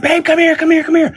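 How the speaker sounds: high, urgent mock call of wife; wife's urgent call. Retelling the wife calling him downstairs, high urgent call. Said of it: "I hear my wife screaming for me downstairs".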